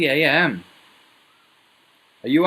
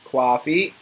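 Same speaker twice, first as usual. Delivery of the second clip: ordinary delivery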